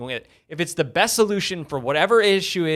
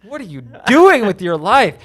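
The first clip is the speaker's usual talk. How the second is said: loud, higher mock outrage; mock scolding shout; 5 semitones higher, 8 dB louder than usual. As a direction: yell at the lazy listener. Scolding listeners who don't subscribe.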